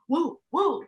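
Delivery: startled reaction. Retold or imitated. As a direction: act jolted by a sudden jump. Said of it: "and I'd be like".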